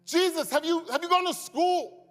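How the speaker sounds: a man who can't believe the trade he's been offered; stunned disbelief. Acted. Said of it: "You see the disbelief?".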